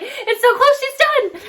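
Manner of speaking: high-pitched, eager exclamation; excited, voice jumping way up; excited self-quote. Retold or imitated. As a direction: recall it with high, insistent excitement. Retelling her own excited remark.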